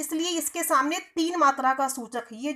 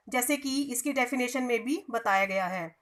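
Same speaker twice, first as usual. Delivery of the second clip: normal talking voice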